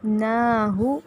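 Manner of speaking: ordinary talk, nothing marked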